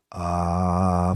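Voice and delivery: low, even, held "ah"; sustained "ah" voice exercise. Shown as a demonstration. Patient repeating his therapy drill, sustained "ah". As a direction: keep the vowel going steadily. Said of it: "for as long as you can".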